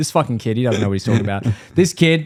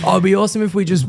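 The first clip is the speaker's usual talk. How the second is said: higher and fast, hammed-up enthusiasm; mock cheery dad; 6 semitones higher, faster than usual. Son mimicking dad's idea, fast hammed-up enthusiasm. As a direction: oversell it like a dad.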